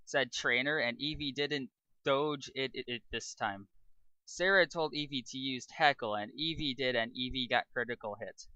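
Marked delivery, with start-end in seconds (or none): none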